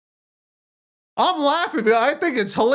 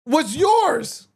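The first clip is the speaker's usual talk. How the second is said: ordinary talk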